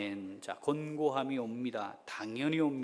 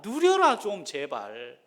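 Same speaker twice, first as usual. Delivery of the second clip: pleading God; 3 semitones higher, 9 dB louder, slower than usual. Acted. God's plea continues slower.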